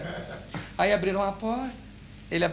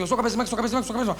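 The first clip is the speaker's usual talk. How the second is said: rattled off at double speed; rapid-fire tongue-twister demo; very fast; 3 semitones higher, much faster than usual. Retold or imitated. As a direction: race through it without a breath. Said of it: "Agora três vezes rapidinho".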